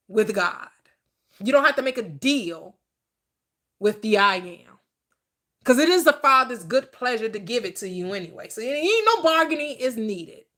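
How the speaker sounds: ordinary talk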